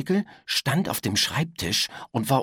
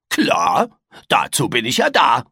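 louder, self-assured boast; a proud little monster showing off; cocky creature; 6 dB louder than usual. A narrator's reading. Creature brags to the boy, louder and cocky.

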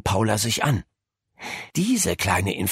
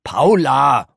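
loud, raised reprimand; thundering; 5 semitones higher, 7 dB louder than usual. A narrator's reading. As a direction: call the name sharply, loud and higher. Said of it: "donnerte Doktor Kuckelkorn". (laut donnernde Ermahnung)

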